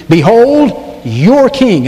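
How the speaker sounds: "your" pressed hard and falling in "Behold, your king"; stress on your. Shown as a demonstration. Preacher reading Pilate's words to the congregation, weight on "your". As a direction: lean on "your" so it means their own king. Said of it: "the emphasis is on the word your".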